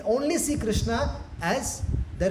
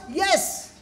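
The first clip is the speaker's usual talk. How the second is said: put-on high-pitched, childlike agreement; acted obedient reply; eager submission; 10 semitones higher than usual. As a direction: give in at once, pitched right up. Krishna giving in to playmates.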